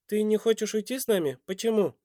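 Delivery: put-on high-pitched boy; child voice. A narrator's reading. Boy asks his father why he won't come, high and childlike.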